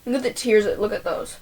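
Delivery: ordinary delivery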